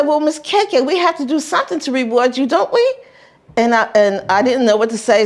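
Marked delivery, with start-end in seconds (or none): 0.0-2.9 high syrupy coaxing voice; sweet-talking man. Retold or imitated. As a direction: charm the little girl. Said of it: "He said".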